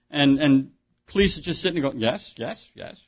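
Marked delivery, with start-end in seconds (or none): 2.0-3.0 stoic yes. Retold or imitated. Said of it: "very stoically".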